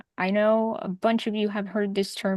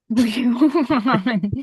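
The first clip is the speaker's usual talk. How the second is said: laughing while repeating it; 3 semitones higher, 8 dB louder, slower than usual. Real, not acted. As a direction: say it through a laugh.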